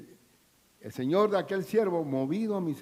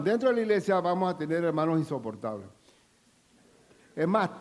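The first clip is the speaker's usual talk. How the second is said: usual speaking voice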